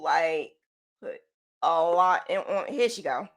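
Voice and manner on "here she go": usual speaking voice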